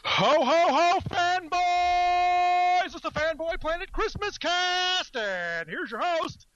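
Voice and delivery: high, slow, booming Santa announcer; jolly Santa voice. Pretend. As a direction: stretch it out like Santa introducing the show. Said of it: "Christmas introduction".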